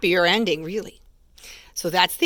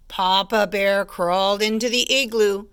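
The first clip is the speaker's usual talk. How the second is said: ordinary delivery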